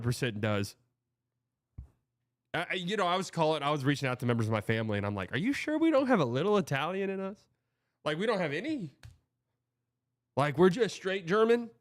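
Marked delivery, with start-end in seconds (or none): 5.3-11.7 high, rising incredulous questions; mock disbelief. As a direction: plead with relatives in exaggerated disbelief. Retold questioning of family, disbelieving.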